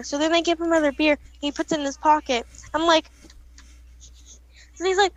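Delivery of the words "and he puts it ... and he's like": ordinary talk, nothing marked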